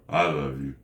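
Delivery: low, quick throwaway; dropped-pitch, unfeeling delivery; insincere. Shown as a demonstration. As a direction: say it without meaning it. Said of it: "it's how you say it".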